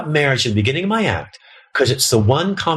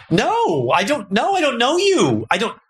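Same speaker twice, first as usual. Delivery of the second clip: mock terrified; 10 semitones higher, slower than usual. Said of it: "it's terrifying to me".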